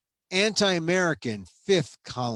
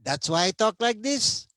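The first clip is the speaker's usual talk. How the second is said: put-on playful Filipino accent, pitched high; a jokey, self-mocking Filipino accent; 8 semitones higher than usual. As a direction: tease your own heavy Filipino accent. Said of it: "with a bad Filipino accent".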